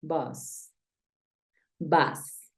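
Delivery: "Vas" as English bus, vowel between A and O; low-pitched model of "Vas", said alone; working the sound. Shown as a demonstration. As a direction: say "Vas" for bus, neither full A nor O. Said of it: "Vamos a ir trabajando en ese sonido".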